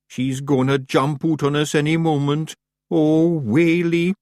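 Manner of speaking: a worried little Scots fairy; small Scottish Feagle muttering. Acted. Feagle voices.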